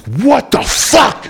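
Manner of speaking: slow, high, drawn-out what the fuck; stunned disbelief. Retold or imitated. Hearing the sentence, stunned and drawn out. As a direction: let it stretch in shock. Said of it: "I'm like".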